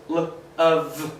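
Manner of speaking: "l of" drawn out slowly, sound by sound; slow modelling. Shown as a demonstration. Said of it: "Just like".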